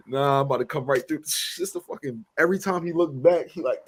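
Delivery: usual speaking voice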